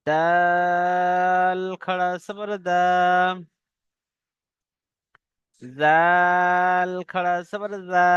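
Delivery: দা and জা each stretched one alif, very slow; slow recitation drill. Shown as a demonstration. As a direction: intone each letter with a long vowel. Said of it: "পড়তে থাকবেন আমার সাথে সাথে".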